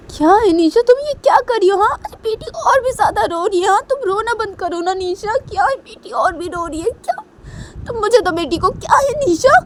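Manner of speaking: very high, loud exasperated outburst; frantic scolding. Acted. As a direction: scold frantically at the top of the pitch.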